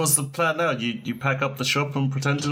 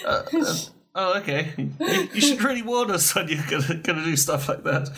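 shaken relief; 6 semitones higher than usual. Real, not acted. Shaken scolding after the prank.

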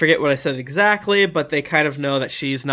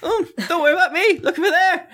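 much higher, squeaky little voice; high squeaky character voice; 14 semitones higher than usual. Acted. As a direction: squeak it like a nervous little man. Nightbread Johnny deflects.

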